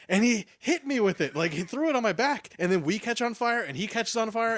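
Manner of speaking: usual speaking voice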